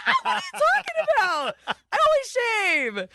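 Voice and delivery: high, fast, far-off protest; mock denial. Teased about shaving, squeaky defensive denial. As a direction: play it innocent and indignant.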